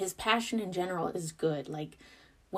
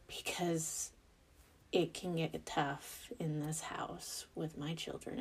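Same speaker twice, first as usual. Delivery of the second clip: whisper; 8 dB quieter than usual. Real, not acted. Confiding hard parenting moments.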